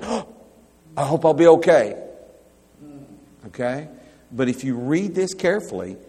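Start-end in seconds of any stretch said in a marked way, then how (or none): 0.0-1.9 higher, louder anxious fretting, hammed-up; mock worried fretting. Preacher mimics an anxious Pharisaical believer, hammed-up worry. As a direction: fret like an unsure believer. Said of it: "you're going".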